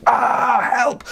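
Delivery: mild scream. Acted. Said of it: "and she screamed".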